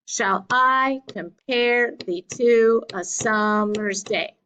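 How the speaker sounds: slow scansion, "shall I com-PARE", beat on every second syllable; iambic reading. Shown as a demonstration. Teacher reading another example line, iambic beat. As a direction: lean on I, pare, to, sum, day. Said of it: "gets the beat".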